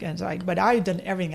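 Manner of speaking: ordinary talk, nothing marked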